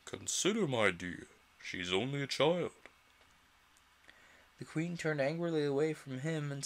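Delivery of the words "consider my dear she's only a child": a lightly voiced meek king, coaxing; a hint of a timid husband. A narrator's reading. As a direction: gently plead with the queen. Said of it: "timidly said".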